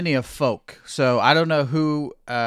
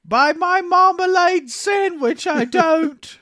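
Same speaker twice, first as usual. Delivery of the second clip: put-on much higher, louder, slow silly British accent; mock British accent; 6 dB louder, slower than usual. Cohost riffing on marmalade in a hammed-up British accent. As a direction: ham up the Brit. Continuing British impressions bit.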